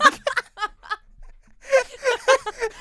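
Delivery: high, loud cracking laugh close to tears; helpless laugh. Real, not acted. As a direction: laugh until you can barely breathe. Laughing at her own note.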